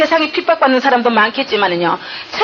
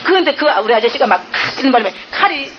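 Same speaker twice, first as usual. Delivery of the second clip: ordinary talk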